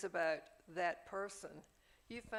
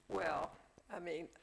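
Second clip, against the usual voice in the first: usual speaking voice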